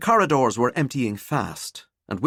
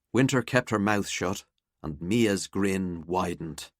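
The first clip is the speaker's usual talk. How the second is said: ordinary delivery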